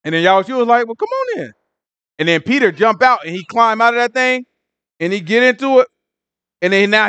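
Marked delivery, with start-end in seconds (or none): 0.9-1.5 high, soft singsong invitation; playful invite. Retold or imitated. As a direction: coax him in lightly, like a game. Yahushua inviting Peter.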